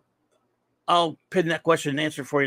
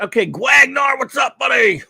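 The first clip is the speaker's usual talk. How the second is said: loud, much higher shout-out to a friend; shouting a greeting; 8 semitones higher, 7 dB louder than usual. Real, not acted. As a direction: call out to him like across a room. Greeting a chat regular.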